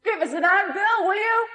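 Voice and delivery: gruff workman shouting high from overhead; distant shout. A narrator's reading. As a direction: call out to Bill from far above. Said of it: "a loud voice shouting".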